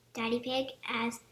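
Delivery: ordinary delivery, nothing marked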